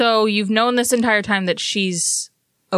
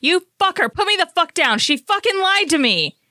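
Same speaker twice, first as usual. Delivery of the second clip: high-pitched, indignant cursing; furious protest in a raised pitch; angry outburst; 9 semitones higher than usual. Acted. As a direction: lash out at someone who lied to you. Character grabbed, furious at the liar.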